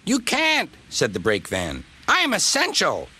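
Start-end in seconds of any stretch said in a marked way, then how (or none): 0.0-0.7 very high, indignant little voice; protesting brake van. A narrator's reading. Brake van protests when threatened. 2.1-3.1 high, self-important little voice; protesting brake van. A narrator's reading.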